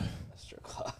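breathy unvoiced whisper. Real, not acted. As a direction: whisper it like a secret. Suggesting a Mad Lib topic.

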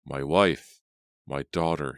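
usual speaking voice

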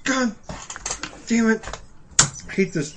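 pained high yelp at the burn; mouth on fire. Real, not acted. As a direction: blurt it out as your mouth burns. Mouth burning from spicy food.

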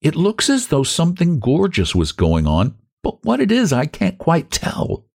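a young girl wondering aloud, lightly played; lightly voiced young woman. Young woman gazing at the shore, lightly voiced, curious.

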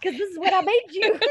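loud, high mock scolding through laughter; play-scold a picky kid, cracking up; laughing mock rant.

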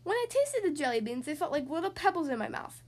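plain reading voice

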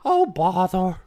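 playful voice-actor impression; Jim Cummings. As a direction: put on a cartoon character's catchphrase. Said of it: "Jim Cummings".

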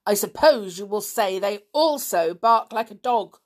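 pointed, irritated sarcasm; sarcastic. A narrator's reading. Stepfather challenging Dick's excuse about gulls, sarcastic.

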